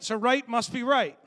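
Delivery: loud, high preaching punch; preacher landing the joke; preaching punchline. Acted. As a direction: drive the point home with full force. Preaching climax.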